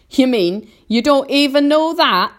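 high, loud centipede jeer; mocking cry. A narrator's reading. Centipede cries out at James's ignorance, loud mockery. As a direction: blare it out in disbelief. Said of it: "cried the centipede".

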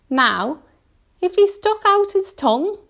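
high creature voice with a smile; earthworm, smiling a little. A narrator's reading. Earthworm proposes using the centipede's tongue, smiling a little. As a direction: propose it with sly cheer. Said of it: "the earthworm said, smiling a little".

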